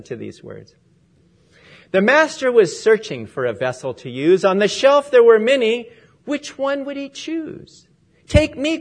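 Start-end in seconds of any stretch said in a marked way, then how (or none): 1.9-7.9 measured, lilting poem reading with rising emphasis; heartfelt church poem read aloud; poem recitation. Acted. 8.3-8.8 louder, higher, eager call of a showy vessel; proud gold vessel crying out. Acted. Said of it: "cried the gold one".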